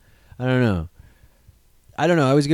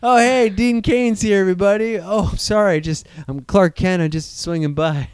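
higher, louder cheerful cameo voice; mock cameo; 6 semitones higher, 4 dB louder than usual. Clark Kent dropping by on Friends, higher and cheerful. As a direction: bounce in like a guest star. Acting out Dean Cain cameo.

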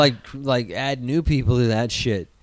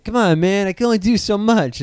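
raised, pleading voice, higher and louder; exasperated plea; 7 semitones higher, 4 dB louder than usual. Retold or imitated. Director pushing back on the studio, exasperated and loud. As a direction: whine that you can only do so much. Retold quote of strained director.

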